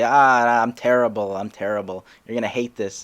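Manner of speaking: loud mock despair, easing off; self-deprecating wail. Comic suggests a warm-up before going on, loud mock self-deprecation. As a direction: cry out your own hopelessness as a joke. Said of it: "self-deprecative route".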